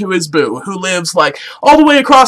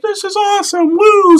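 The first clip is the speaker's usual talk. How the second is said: high-pitched excited squeal; hammed-up delighted woo; Momoa; 11 semitones higher than usual. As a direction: cheer in a much higher voice, rising to a whoop. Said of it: "Momoa's like".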